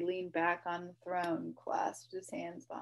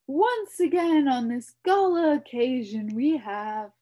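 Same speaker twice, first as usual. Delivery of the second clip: royal address; 6 semitones higher, 12 dB louder than usual. A narrator's reading. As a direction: address the court grandly. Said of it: "continued Azaz".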